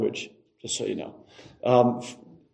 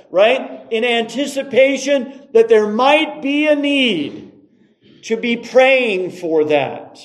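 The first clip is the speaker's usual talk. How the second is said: high, driving sermon delivery; emphatic preaching; 7 semitones higher, 4 dB louder than usual. Acted. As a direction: stretch and punch the key words. Preaches on praying before need.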